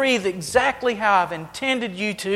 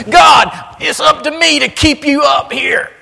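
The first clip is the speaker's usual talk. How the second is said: high, loud, hammed-up shout; mock self-important believer; 8 semitones higher, 10 dB louder than usual. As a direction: shout it like a silly know-it-all propping God up. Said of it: "Isn't that silly?".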